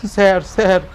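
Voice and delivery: almost crying out for mercy; near tears. A narrator's reading. Thief nearly in tears begging the homeowner, high and loud. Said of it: "ভাঙা গলায় প্রায় কেঁদেই ফেলল".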